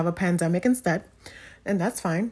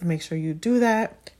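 ordinary talk, nothing marked

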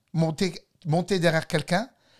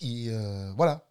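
ordinary talk

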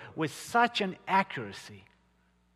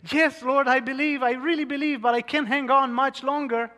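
a man clinging to a branch, pleading higher and louder; desperate pleading; 9 semitones higher, 5 dB louder than usual. Acted. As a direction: beg while hanging on. Dangling man answers God.

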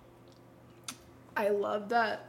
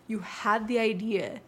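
usual speaking voice

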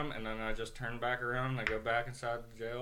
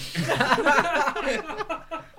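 loud high burst of laughter; real loud laughter; 13 dB louder than usual. Real, not acted. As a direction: break up laughing at the memory. Teller cracks up.

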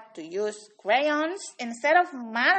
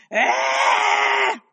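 frustrated cry-shout; 7 semitones higher than usual. Acted. Melvin melts down in frustration.

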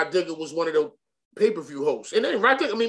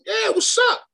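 sudden high, loud "what's up"; high, loud and keen; eager yes; 4 dB louder than usual. Retold or imitated. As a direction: jump at the offer. Eager yes to the video game offer.

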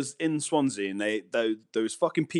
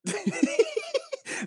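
high-pitched giggling fit; real laughter; 3 dB quieter than usual. Real, not acted. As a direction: giggle guiltily. Laughs at dark joke.

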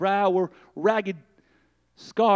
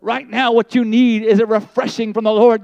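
louder, urgent preaching; 8 dB louder than usual. Acted. Preacher telling listeners what they need, loud. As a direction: press it on the congregation.

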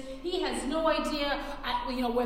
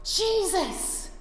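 higher and louder desperate call; 6 semitones higher, 4 dB louder, much slower than usual. Retold or imitated. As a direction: cry out for Jesus from the roadside. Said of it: "he starts to shout".

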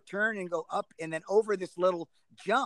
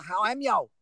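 higher-pitched attempt at West Midlands; West Midlands accent; 4 semitones higher, 5 dB louder than usual. Shown as a demonstration. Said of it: "Give us a bit of the West Midlands accent".

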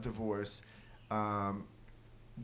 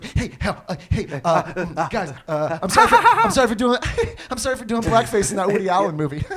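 high, loud, stammering apology broken up by laughs; hammed-up, giggly talk-show host apologizing; Jimmy Fallon; 7 semitones higher, 10 dB louder than usual. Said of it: "that was a pretty good impression".

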